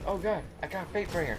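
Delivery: relieved gasp. Retold or imitated. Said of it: "and then I was like".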